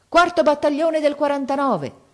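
high, loud battle-cry; frantic yell. A narrator's reading. Old soldier calling to the king, yelled. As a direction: yell it out, losing all restraint. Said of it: "perdé la testa e urlò".

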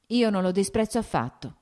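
usual speaking voice

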